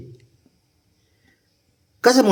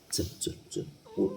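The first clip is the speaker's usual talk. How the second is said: barely audible whispered urging; 4 semitones lower, 17 dB quieter than usual. Real, not acted. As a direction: breathe the words, almost silent. Whispering while coaxing to bed.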